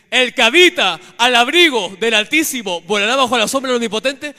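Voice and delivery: a psalm roared out high and very loud; shouted rebuke. Real, not acted. Preacher rebuking the demon in prayer, shouting the psalm. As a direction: hurl the verse at the demon.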